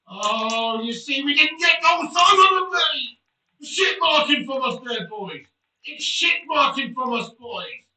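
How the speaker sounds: loud, high-pitched team rant; exasperated shouting. Real, not acted. Player scolding team over shit marking, exasperated shout. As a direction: berate your defenders, really loud. Ranting at team after conceding.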